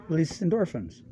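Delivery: normal talking voice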